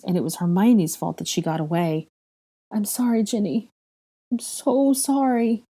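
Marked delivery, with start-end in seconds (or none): 2.7-5.6 high, slow, broken apology; high tearful apology to Ginny; grieving apology. A narrator's reading. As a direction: apologize through grief.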